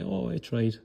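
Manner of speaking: soft, limp excuse voice, trailing off; a mock victim's deflated excuse; defeated whine. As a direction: sound like you've already given up. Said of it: "you even hear it in their voice".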